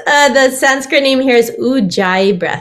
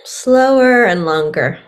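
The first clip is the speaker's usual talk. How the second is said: guided meditation; 7 dB quieter than usual. Acted. Guides a breathing exercise.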